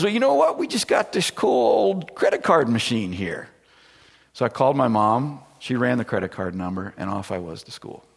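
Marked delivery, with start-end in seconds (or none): 0.0-3.5 very high, put-on folksy voice of the tow driver; a country mechanic proudly showing off his old machine; tow truck guy. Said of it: "He goes".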